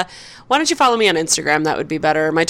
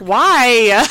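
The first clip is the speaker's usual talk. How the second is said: ordinary talk, nothing marked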